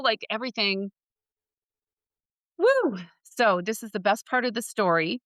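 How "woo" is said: cheering whoop. Real, not acted. Cheering at the happy news.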